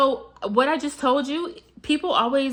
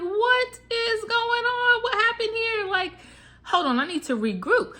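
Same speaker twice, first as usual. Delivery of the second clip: confused self-quote; 12 semitones higher than usual. Retold or imitated. Retelling her manifesting hiccup to viewers, high bewildered voice. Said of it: "all of a sudden I'm like".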